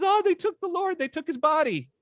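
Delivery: high, plaintive voice; Mary in tears, telling the angels; Mary's voice. Retold or imitated. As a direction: lament the missing body. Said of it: "She says".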